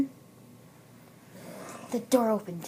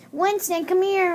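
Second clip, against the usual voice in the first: high, raised call; calling the dog; 9 semitones higher, 5 dB louder than usual. Acted. Girl at the door to her dog, calling out high. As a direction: call out for your pet.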